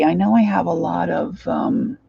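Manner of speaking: ordinary talk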